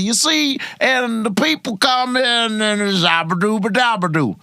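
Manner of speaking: playful Cosby jibberish; Cosby impression. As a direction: do Cosby's rambling scat.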